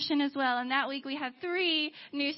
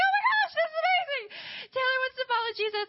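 very high, thrilled shriek; imitated excited squeal; 12 semitones higher than usual. Friends reacting to Taylor following Jesus, squealing with joy. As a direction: gush in a squealing, overjoyed voice. Acted group reaction to conversion.

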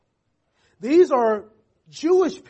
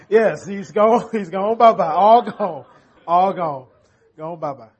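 laughing; 6 dB louder than usual. Retold or imitated. Laughing retell to toddler son.

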